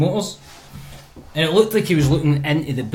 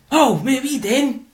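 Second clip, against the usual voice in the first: higher-pitched retold call to a stranger; retold challenge; 9 semitones higher than usual. Speaker challenges man urinating on car, indignant call. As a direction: imitate yourself challenging someone.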